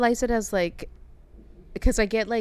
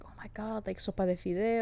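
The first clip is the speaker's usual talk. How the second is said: whispered longing; 5 dB quieter than usual. Retold or imitated. Craving comfort food.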